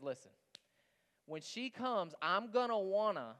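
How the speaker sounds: a hint of a scheming cartoon crab; Mister Krabs. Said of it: "he goes to SpongeBob and he says".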